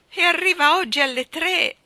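high, very loud wail; despairing, high-pitched lament; loud moan. A narrator's reading. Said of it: "gemette ad alta voce".

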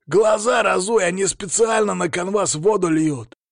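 high, loud, indignant shout; angry yelling. A narrator's reading. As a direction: yell in outrage at the referee. Angry coach yelling at the referee. (гневный крик на высоких тонах)

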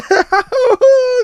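high hammed-up wail with no words; a big mock boo-hoo; loud wailing sob. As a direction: let out a long comic sob. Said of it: "嚎啕大哭".

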